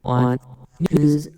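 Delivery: loud processed alien voice effect; alien voice changer. Real, not acted. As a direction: voice run through an alien filter. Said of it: "The alien is an alien".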